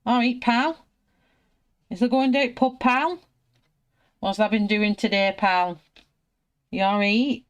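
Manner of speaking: loud, high, broad northern English banter; local northern English dialect. Pretend. Painter showing talk up here, exaggerated northern English accent. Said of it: "up here where I am, it's".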